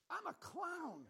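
high, loud, baffled outburst; incredulous exclamation. Retold or imitated. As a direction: blurt it out in comic disbelief. Incredulous inner reaction in story.

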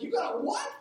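high, disbelieving yelp; incredulous. Retold or imitated. Pastor mock-outraged as his wife leaves for the game. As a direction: react as if abandoned mid-job.

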